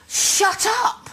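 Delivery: high, teasing "Shut up"; mock bossy snap. Friend mimicking Carol's blunt teasing put-down. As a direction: cut her off with a playful put-down. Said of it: "and she will say".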